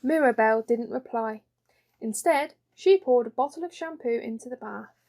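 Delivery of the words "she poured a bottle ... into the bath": usual speaking voice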